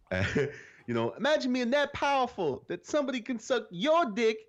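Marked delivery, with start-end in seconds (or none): 1.1-4.4 high, slow comic voice; Dave Chappelle impression. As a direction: squeak it out like Chappelle doing Clinton. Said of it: "and he's like".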